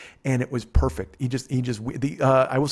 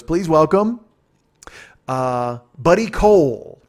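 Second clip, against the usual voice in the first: higher, louder showman introduction, drawn out on the name; announcer intro; 4 semitones higher, 7 dB louder, slower than usual. Retold or imitated. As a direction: belt the name like a showbiz host. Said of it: "And Martin Short goes".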